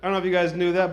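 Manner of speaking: ordinary talk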